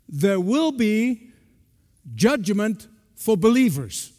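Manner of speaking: slow, every word spelled out; over enunciating. Shown as a demonstration. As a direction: press "will" and say it slowly, syllable by syllable. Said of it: "please read my lips".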